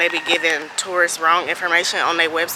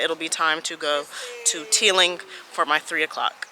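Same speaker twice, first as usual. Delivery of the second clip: normal talking voice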